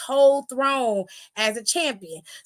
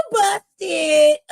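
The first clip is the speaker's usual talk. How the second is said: gleeful shout; 3 semitones higher, 6 dB louder than usual. Real, not acted. Crowing over the slip.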